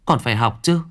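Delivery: mother frantically forbidding her son to quit; alarmed mother. A narrator's reading. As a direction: refuse firmly and climb in pitch. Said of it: "giật mình, lắc đầu".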